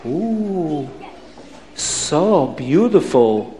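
slow, cooing wonder; awed Abdallah. Acted. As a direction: marvel in drawn-out accented oohs. Abdallah awed by third pearl.

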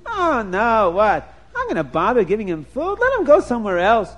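high, careless wife voice; first wife voice. Acted. Wife brushing off the old visitor, high and careless. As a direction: toss it off like a lazy wife.

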